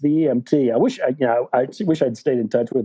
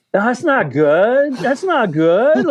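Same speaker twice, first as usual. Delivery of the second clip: dismayed; 11 semitones higher, 4 dB louder, much slower than usual. Retold or imitated. Reacting to the EMT's alarm.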